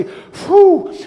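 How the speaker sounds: high loud relieved exhale; relieved whew. Retold or imitated. Disciples wiping their brow, loud relieved whew. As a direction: blow out relief after the storm. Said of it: "wipe their brow and say".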